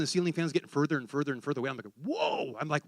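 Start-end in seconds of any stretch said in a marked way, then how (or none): 2.0-2.6 high, soft, drawn-out whoa; amazed whoa. Retold or imitated. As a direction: let an awed whoa float up high. Acts his amazed cry while leaving body.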